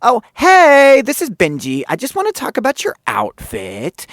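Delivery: very high, loud, super happy greeting; imitation of an over-happy producer; Benji. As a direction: burst in with a squealed hello. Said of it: "like, high-pitched, super happy fucking voice".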